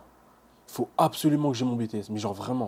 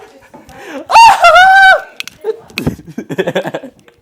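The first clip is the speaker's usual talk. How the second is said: long high scream-laugh rising; shrieking laugh; 18 dB louder than usual. Real, not acted. Student celebrates passing, long shrieking laugh. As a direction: howl with laughing joy.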